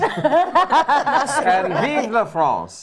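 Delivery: drawn-out, laughing mock French accent; hammed-up French accent through a laugh; a playful French accent. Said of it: "that was my attempt at a French accent".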